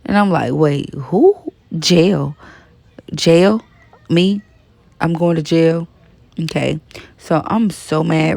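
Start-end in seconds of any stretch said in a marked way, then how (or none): 0.5-5.9 retold disbelief in short, separated questions; mildly acted bewilderment; incredulous questioning. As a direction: replay the stunned double take, pausing after each question. Acts out disbelief at jail news.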